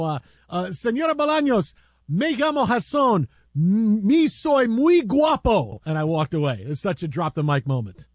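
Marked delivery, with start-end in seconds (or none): 0.7-5.8 slow playful Spanish line; a mock suave boast in Spanish; retold Spanish-accented boast. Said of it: "I go".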